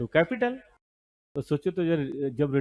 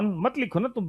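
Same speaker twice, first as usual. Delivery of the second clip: ordinary talk, nothing marked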